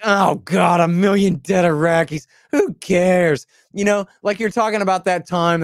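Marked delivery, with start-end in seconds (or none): 0.0-3.5 louder, higher mocking groan of a crowd; exasperated, put-on crowd voice; mock dismissive groan. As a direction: imitate an audience that couldn't care less. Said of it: "And they're just like".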